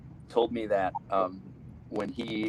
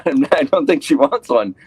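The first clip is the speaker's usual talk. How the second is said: louder, firm no through a laugh; firm refusal; 4 semitones higher, 11 dB louder than usual. Retold or imitated. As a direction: push back hard, half laughing. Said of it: "I go".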